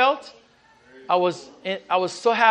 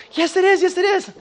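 higher, insistent repeat; wife insisting; 10 semitones higher than usual. Retold or imitated. Preacher voicing his wife insisting it is him. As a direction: press it like a wife who is sure. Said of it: "And she goes".

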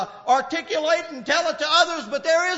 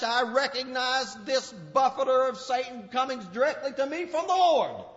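normal talking voice

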